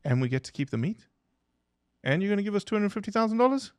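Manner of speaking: high, fast, disbelieving; can't believe the offer; amazed disbelief. Retold or imitated. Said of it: "they're like".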